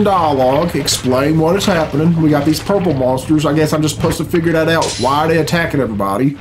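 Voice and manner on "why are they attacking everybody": usual speaking voice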